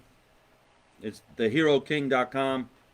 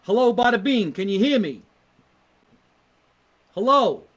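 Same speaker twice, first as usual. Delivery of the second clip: calling out; 5 semitones higher, 6 dB louder than usual. Real, not acted. Calling out on a video call.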